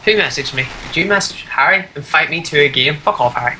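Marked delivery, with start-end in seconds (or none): none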